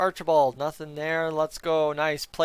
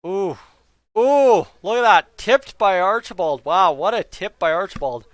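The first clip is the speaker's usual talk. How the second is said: excited; 2 semitones higher, 8 dB louder than usual. Real, not acted. Amazing tip in hockey game.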